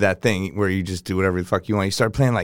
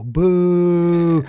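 long, higher-pitched mock boo; drawn-out jeer, raised in pitch; crowd boo; 7 semitones higher than usual. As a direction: imitate a whole club booing. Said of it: "you know that when they go".

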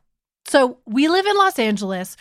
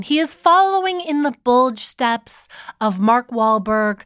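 ordinary delivery

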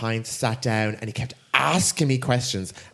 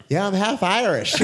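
high put-on accent sliding towards Irish; half-hearted cover-up, letting the Irish accent in; slipping into Irish accent; 8 semitones higher, 4 dB louder than usual. Excusing the changed accent to a date, high, slipping into Irish. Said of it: "slowly slip in the Irish accent".

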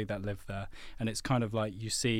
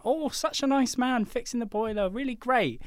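high-pitched mock gushing; gushing praise; 12 semitones higher than usual. Household praising the plumber, hammed-up high gushing. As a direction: coo it in a much higher put-on voice.

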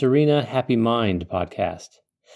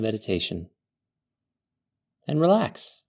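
calm meditative tone; 3 semitones lower than usual. Acted. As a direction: ease the listener into calm. Leads a guided desert meditation.

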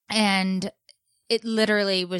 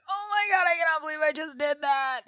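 high, squeaky mock wail; tearful horror, imitated; 9 semitones higher than usual. Mom retelling her shock at what she did, mock tearful wail. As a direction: re-enact dawning guilt on the edge of tears. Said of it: "And I started to cry".

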